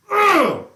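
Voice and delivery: loud, high disgusted outburst; exasperated groan. Real, not acted. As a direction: let out a sudden loud "ugh". Disgusted outburst at the jet boast.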